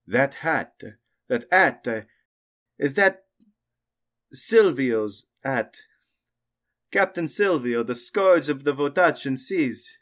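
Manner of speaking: astonished merchant recognising a pirate's hat; merchant man. Acted. Merchant man leans in and says.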